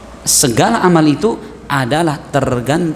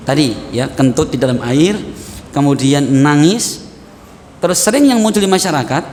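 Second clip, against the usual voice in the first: ordinary delivery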